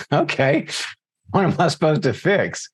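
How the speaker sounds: puzzled reply with lifted pitch; baffled retort. Retold or imitated. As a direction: play up mild bewilderment at the request. Retold baffled reply to mother.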